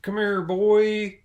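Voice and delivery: high, loud cartoon father calling his son; cartoon dad calling. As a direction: holler for the boy, slow on boy. Opening skit calling a boy.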